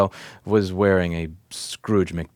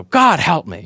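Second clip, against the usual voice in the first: loud, higher, hammed-up cry to the heavens; imitating a mother's dramatic outburst; dramatic plea; 7 semitones higher, 7 dB louder, slower than usual. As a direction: mimic a mother's theatrical plea for help. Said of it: "in this dramatic fashion go".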